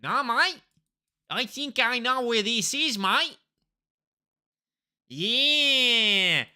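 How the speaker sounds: loud, high-pitched mock Aussie; Australian accent. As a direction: ham up a broad Australian accent. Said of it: "I do an accent of where I think I am".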